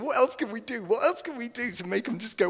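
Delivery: high-pitched, excited brainstorming; acting out busy, eager scheming; eager planning. Acts out Jesus eagerly planning.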